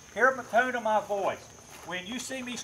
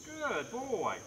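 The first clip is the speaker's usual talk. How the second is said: warm praise; 5 semitones higher, 5 dB quieter than usual. Real, not acted. Praising dog after sit.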